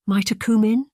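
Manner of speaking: high, timid, trembling request; frail and fearful. Acted. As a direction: ask as a frightened servant. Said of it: "in a frail voice filled with trepidation".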